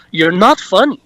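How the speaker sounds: put-on curt French-accented put-down. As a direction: imitate an unamused Frenchman's accent. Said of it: "That's my French impression".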